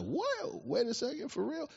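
a startled reader doubting the article; reading the article on hidden water, mock amazed; amazed disbelief. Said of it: "I was like".

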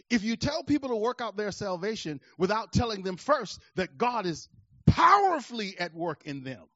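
normal talking voice

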